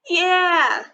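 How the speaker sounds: loud high cheer; big boys yelling from a window. A narrator's reading. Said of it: "yelled some of the big boys".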